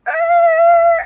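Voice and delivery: loud, high screeching noise; imitated car screech. Retelling the car flying at her, loud high screech. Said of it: "he just went".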